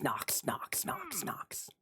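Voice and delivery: fading breathy repeated whisper; playful whispered echo. Pretend.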